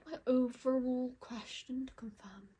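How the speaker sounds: unvoiced hushed whisper. Real, not acted. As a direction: breathe the question quietly. Whispered question to the cards.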